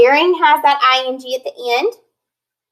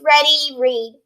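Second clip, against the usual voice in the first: usual speaking voice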